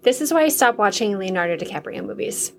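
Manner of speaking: ordinary delivery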